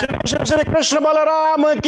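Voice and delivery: high shouted acclamation; leading a cheer. Real, not acted. Leader hails Krishna Balaram to the crowd, high shouted cheer.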